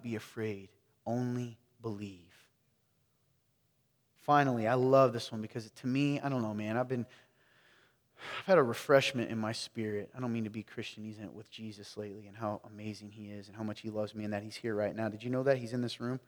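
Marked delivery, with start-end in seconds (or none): none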